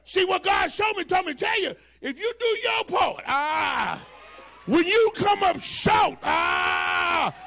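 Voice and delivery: high, shouted sermon cadence, stretching the punch words; full-force preaching. Acted. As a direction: drive the sermon home at full volume.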